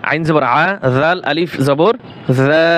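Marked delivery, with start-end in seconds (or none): none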